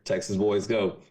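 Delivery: ordinary delivery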